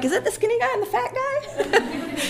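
put-on high, laughing kid voice; a child's giggly question; quoting kids. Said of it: "They're like".

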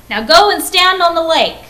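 loud, high harsh order. A narrator's reading. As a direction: shout the order. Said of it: "he ordered".

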